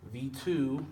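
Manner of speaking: "V two" as unstressed then stressed, rising onto "two"; iambic foot. Shown as a demonstration. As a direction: lift the second word of "V two".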